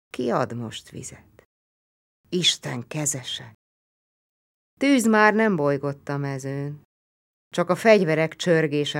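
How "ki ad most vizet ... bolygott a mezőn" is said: ordinary delivery, nothing marked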